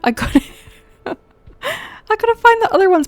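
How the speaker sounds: high laughing, slow. Real, not acted. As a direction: laugh through the words. Laughs at own blindness.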